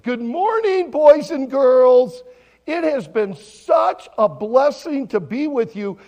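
loud, high, drawn-out greeting; children's chapel greeting. Retold or imitated. Pastor greeting children at morning chapel, big and bright. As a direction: boom it out slowly to a room of kids.

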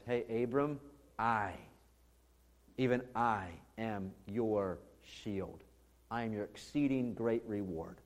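soft, low and slow, with pauses round "I"; calm a worried man, quietly and deliberately; hushed, low, measured assurance; gentle divine reassurance. Acted. God gently calls back distracted Abram.

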